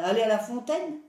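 loud, scornful refusal; spoiled sister snapping at her mother, loud and scornful; rude retort. A narrator's reading. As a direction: bark it back like a spoiled brat. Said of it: "répondit la brutale".

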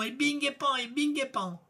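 high, rhythmic sing-out of whacks; excited storybook beating; imitated blows. A narrator's reading. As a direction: bounce through the hits at a high pitch. Narrator acts out the blows.

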